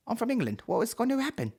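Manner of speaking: very high, mock English accent; bad English accent. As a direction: squeak out a badly faked English accent. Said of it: "I've got an English accent now".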